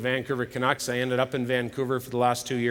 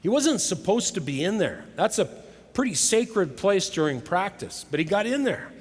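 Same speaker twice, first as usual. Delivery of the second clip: ordinary talk, nothing marked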